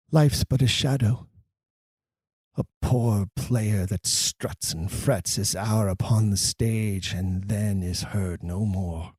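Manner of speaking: low, slow and hushed, sinking to nothing; a slow, grave tragic reading; dramatic Shakespeare recitation. Shown as a demonstration. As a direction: deliver the speech with brooding weight. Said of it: "Here's a great example".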